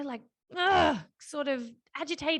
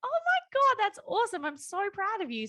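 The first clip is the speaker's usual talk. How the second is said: much higher and louder, bursting with real joy; genuine delight; 10 semitones higher, 4 dB louder than usual. Retold or imitated. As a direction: gush like a proud mum who means it. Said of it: "sort of smile".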